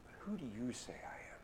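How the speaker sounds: a soft, searching whisper. A narrator's reading. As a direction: breathe the question straight into his eyes. Jesus questions Peter face to face.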